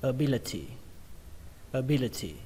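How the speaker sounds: ABILITY with clear T, falling after BI; stress on BI. Shown as a demonstration. Teacher repeating the word for learners, stress on BI. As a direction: hit BI in "ability", then drop. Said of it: "trọng âm của nó rơi vào âm B".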